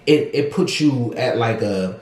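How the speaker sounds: normal talking voice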